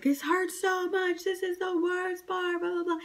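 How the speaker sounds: high, strained pained quote; high mock wail. Mother in labor to the doctor, high pained wail. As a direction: squeal it out like it hurts. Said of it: "I literally was screaming".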